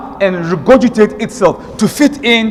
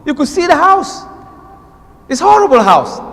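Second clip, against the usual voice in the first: mocking exclamation; 7 semitones higher than usual. Real, not acted. Mocking spider house.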